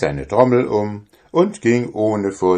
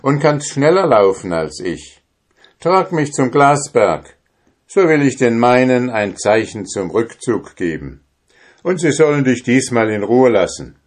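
bold drummer; 6 semitones higher, 3 dB louder than usual. A narrator's reading. Drummer commands giant.

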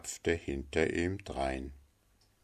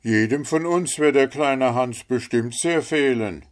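louder, higher smith's voice; sociable smith; 7 semitones higher, 6 dB louder than usual. Acted. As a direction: speak up to the company as the smith. Figure of the smith. (Schmied, laut und mit gehobener Stimme)

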